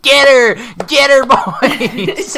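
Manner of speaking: high, slow, laughing whoop; mock hollering teacher. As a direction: cheer the fight on like a rowdy teacher. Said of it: "like, hooting and hollering".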